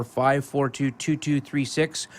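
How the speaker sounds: ordinary talk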